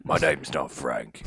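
low, soft, drawn-out hero voice; slow, deep tough-guy correction; mock action hero. Transporter correcting the French guy on his name, low and slow mock menace.

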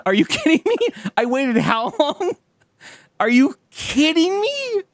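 mock fury. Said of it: "I would be fucking mad".

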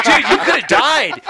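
high, loud, slow through laughter; mock scolding through laughter; laughing quote. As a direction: laugh while quoting the friend. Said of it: "He goes".

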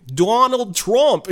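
put-on high, slow, laughing New York accent; a hammed-up New York accent, slow; badly faked New York accent. Podcast host tries a New York accent for Donald Trump, badly faked. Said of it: "I can't do it".

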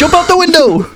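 high squeaky doll urging. Pretend. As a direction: squeak an urgent order like a doll. Doll-dad bit continues.

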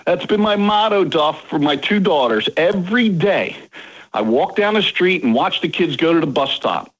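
ordinary talk, nothing marked

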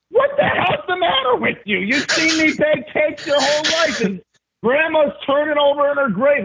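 high, shrill-pitched yelling aunt; aunt screaming. Retold or imitated. Baker aunt yelling at her nephew over his cake, furious shriek. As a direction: shriek it like an outraged aunt near tears. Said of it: "she was screaming at me".

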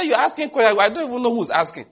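loud, high sharp rebuke on the phone. Retold or imitated. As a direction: snap at the caller with authority. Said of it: "with a kind of tone".

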